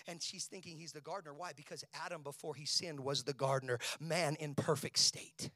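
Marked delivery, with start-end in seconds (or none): none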